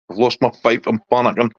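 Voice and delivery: ordinary talk, nothing marked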